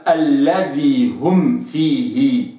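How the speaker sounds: الذي هم with the ي kept full before هم, slow; correct reading. Shown as a demonstration. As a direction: finish the kasra in الذي هم. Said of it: "هكذا نطقا صحيحا".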